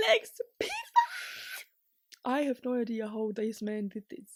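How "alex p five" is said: high, slow, laughing cry; giddy laughing cheer; laughing shout. Real, not acted. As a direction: laugh it out in delight. Joyful about another result.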